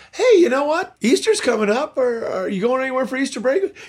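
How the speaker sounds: high, bright, eager chatter; eager question. Retold or imitated. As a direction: pitch it up like friendly excited small talk. Retelling his eager small talk.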